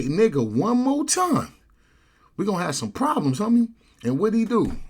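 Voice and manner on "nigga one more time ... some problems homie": high, loud final warning; heated, pitched-up challenge; retold threat. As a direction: imitate a man threatening a fight. Retold threat before a fight.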